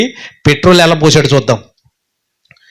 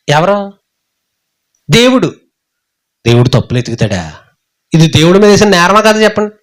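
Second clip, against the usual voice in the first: normal talking voice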